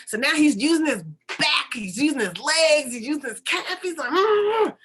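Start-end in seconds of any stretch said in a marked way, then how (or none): 4.1-4.8 loud, high effortful grunt; mimed heaving at a huge weight; acted strained groan; straining grunt. Straining to push the boulder.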